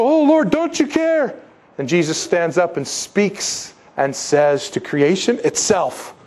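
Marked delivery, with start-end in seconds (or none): 0.0-1.4 very high, slow mock plea; hammed-up high whine; whining disciples. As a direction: wail it like a frightened child. Disciples' cry, mocked again.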